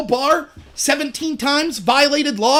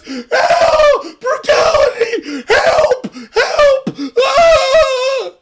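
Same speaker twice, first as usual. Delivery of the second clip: high, loud hammed-up cry for help; fake victim cry; 13 semitones higher, 7 dB louder, much slower than usual. Mocking a protester playing victim, hammed-up shriek. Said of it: "that same girl ... goes".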